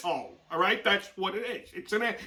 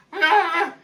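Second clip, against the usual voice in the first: startled gasp; 11 semitones higher than usual. Retold or imitated. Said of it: "she's like".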